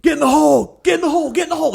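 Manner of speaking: high mock fan shout of get in the hole; mock golf fans. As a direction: imitate golf fans yelling. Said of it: "and they go".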